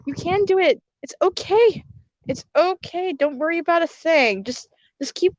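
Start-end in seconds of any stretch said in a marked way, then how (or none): none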